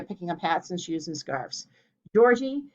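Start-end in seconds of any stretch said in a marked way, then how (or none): 2.1-2.6 high, loud alarmed name. A narrator's reading. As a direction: gasp his name in alarm. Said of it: "Aurelia said in alarm".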